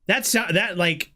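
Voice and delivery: usual speaking voice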